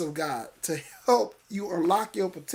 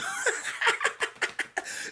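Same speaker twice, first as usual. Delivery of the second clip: high, quiet cackle; high-pitched gleeful laughing; delighted laugh; 6 dB quieter than usual. Real, not acted. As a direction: let out a squealing run of laughter. Laughs after his own preached line.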